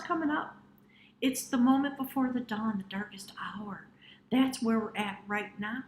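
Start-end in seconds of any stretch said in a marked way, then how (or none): 2.8-3.9 hushed, breathy whisper of the darkest hour. Acted. Reader setting the scene for viewers, in a whisper. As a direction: drop to a dramatic whisper. Dramatic reading of dawn image.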